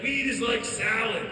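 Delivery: slow, loud, lightly mocked stoner brother; Rick. Brother excuses getting caught with weed, cartoon voice. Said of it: "Rick from Rick and Morty voice".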